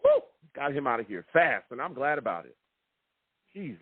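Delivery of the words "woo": short, loud "woo" of glee; celebratory whoop. Real, not acted. Host cheering a coach's exit, short whoop. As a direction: let out a quick cheering whoop.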